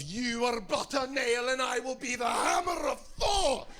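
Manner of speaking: high, slow, epic Norwegian warrior dog; Norwegian accent. Acted. Norwegian terrier threatening another dog, high and grandiose Norwegian accent. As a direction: declaim it like a tiny Norwegian Viking. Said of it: "because they are Norwegian".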